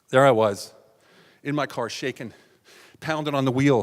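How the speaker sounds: normal talking voice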